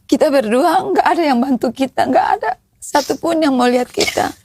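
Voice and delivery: telling it while close to crying; tearful. Real, not acted. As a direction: voice thick with tears, holding back sobs. (suara menahan tangis)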